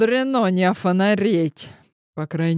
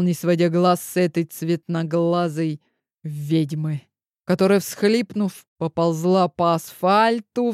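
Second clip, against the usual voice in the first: ordinary delivery, nothing marked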